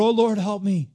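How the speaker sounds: loud imitated prayer for help; pleading cry to the Lord. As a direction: beg God out loud, urgently. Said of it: "they'll cry out to the Lord".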